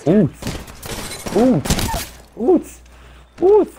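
high startled putz yelps; surprised yelps. Real, not acted. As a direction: jolt up with each putz. Reacting to a shotgun blast.

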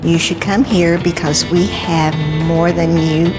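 normal talking voice

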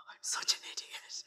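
unvoiced despairing whisper; whispered self-scold. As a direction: whisper it to yourself. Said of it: "I'm just like".

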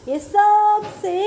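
सबसे drawn out slow and high; emphatic stretch. Real, not acted. Teacher revealing the longest word, सबसे stretched emphatically. Stressing the riddle's superlative.

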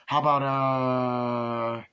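long held, slow "uh"; acted customer searching for an option; drawn-out hesitation. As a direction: stretch it out while thinking. Acts customer thinking what to order.

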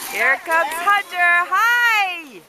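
loud, high, stretched-out cheer; excited calling. Real, not acted. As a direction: greet the arriving little skier with a happy shout. Cheering as child skis toward parent.

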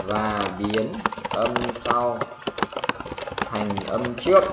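drawn-out words with long gaps; slow, spaced-out rule dictation; piece by piece. Shown as a demonstration. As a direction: dictate it slowly in small chunks. Teacher continues rule piece by piece.

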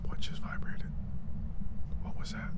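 hushed frightened questions; scared whisper. Real, not acted. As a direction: whisper in sudden alarm.

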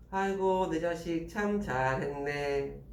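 느릿느릿 비꼬는 엄마 연기; 속뜻은 반대인 듯 길게 늘여 칭찬하기; 반어적인 칭찬. Acted. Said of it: "못했다는 표현을 하고 싶은 거지". (비꼬듯 늘여 말하는 엄마)

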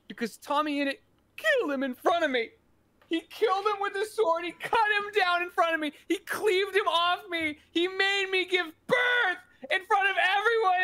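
high-pitched, hammed-up grief building to a shout; mock anguished outcry. Mourner telling the funeral how he was killed, hammed-up anguish. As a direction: wail the accusation, climbing as it builds.

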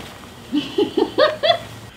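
airy laugh through a mask; quick high giggle; high breathy laugh. Real, not acted. Laughing after the test.

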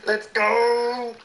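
high, loud drawn-out shout; excited cheer. Real, not acted. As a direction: cheer it out loud, stretching the end. Celebrating in a game.

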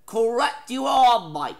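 hammed-up Australian accent, slow and loud; a bad, loud Australian twang on the praise. Host to contestant, a bad Australian accent. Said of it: "Crikey, Mike. Why you do this? Why you embarrassing Australians".

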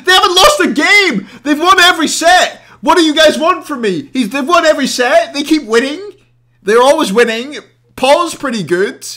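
loud, high-pitched rant at the chat; indignant shouting. Real, not acted. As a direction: yell your disbelief at the doubters.

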